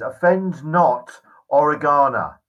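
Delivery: slow, booming statue voice; loud, slow, commanding; seductive statue voice. Acted. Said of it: "a strangely deep and seductive voice".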